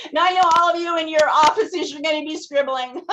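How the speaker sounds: talking through laughter; laughing. Real, not acted. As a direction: laugh while you say it. Laughing while teasing the audience.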